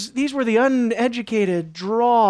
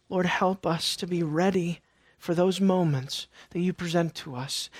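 quiet prayer; 4 semitones lower, 8 dB quieter than usual. Real, not acted. Preacher breaks into brief prayer.

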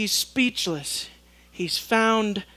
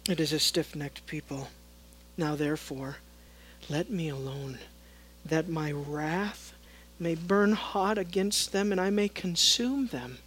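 quiet grim God; 4 semitones lower, 7 dB quieter than usual. Acted. Preacher voicing God's wrath.

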